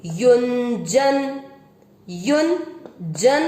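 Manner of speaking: युंजन said very slowly with a nasal न before ज; careful teacher demo of युंजन. Shown as a demonstration. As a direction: stretch out युंजन, sounding the half न. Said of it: "यू पे बिंदी लगेगी आधे न की".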